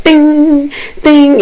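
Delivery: high ringing ding; held high note, like a chime; imitated ding. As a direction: mimic a spoon striking porcelain. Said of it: "hitting the shit against the goddamn porcelain".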